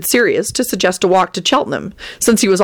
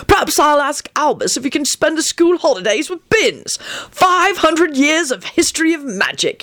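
relishing every word; 11 semitones higher than usual. A narrator's reading. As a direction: savour the threat in a high voice. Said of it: "he said with relish".

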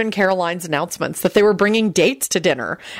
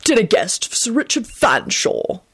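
slow, stiff formal announcement; stiffly formal, measured and slow; stiff, haughty butler; 3 semitones higher, slower than usual. A narrator's reading. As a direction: pronounce each part like a haughty butler. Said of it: "stiffly pronounced".

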